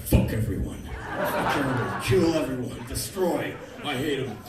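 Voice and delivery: low, loud, seething rant; low-pitched comic fury; pissed-off raging. Acted. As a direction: spit out murderous hatred of everyone. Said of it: "I was so pissed off".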